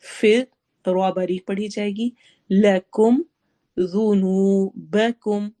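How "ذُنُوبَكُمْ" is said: ذُنُوبَكُمْ with a soft ذ and a long نو; soft dhal. Shown as a demonstration. Teacher showing the soft ذال, slow. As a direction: say the ذ softly, stretch نو. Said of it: "ذال کو نرمی سے پڑھنا ہے".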